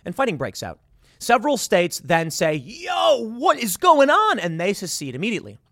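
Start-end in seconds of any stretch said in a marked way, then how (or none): 2.6-4.4 high, drawn-out "yo" of disbelief; mock alarmed outcry. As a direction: shout it in comic alarm, pitched way up. States react to secession in retelling.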